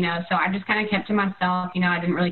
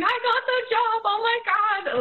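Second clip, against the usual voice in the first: very high-pitched overjoyed squeal, retold; imitating high, happy crying; tearful joy. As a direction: re-enact bursting with happy disbelief. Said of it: "crying. I was like".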